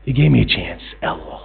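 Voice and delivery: usual speaking voice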